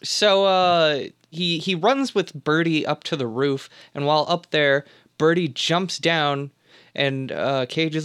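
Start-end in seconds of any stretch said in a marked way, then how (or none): none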